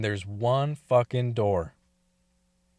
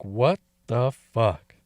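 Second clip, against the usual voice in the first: slow, low, flattened what the fuck; low stunned disbelief; 5 semitones lower, slower than usual. Retold or imitated. Reacting to Ava's plan for Nance, low slow dismay. Pulled the pecker in restaurant.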